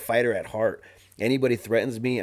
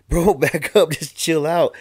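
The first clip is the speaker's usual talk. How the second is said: the same warning, much louder and higher, half-laughing; louder firm warning; 7 semitones higher, 9 dB louder, slower than usual. Retold or imitated. Telling the angry guy with the knife to back off, loud and firm. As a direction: push him back with a raised voice.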